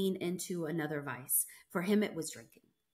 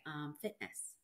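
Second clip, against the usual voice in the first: ordinary talk, nothing marked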